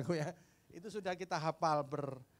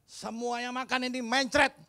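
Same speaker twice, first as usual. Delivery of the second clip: higher, louder stern threat; threatening pastor; 9 semitones higher, 9 dB louder than usual. Retold or imitated. Pastor threatening the orphans who won't confess, higher and louder. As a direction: threaten them with a prayer. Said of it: "kata Pak Pendeta".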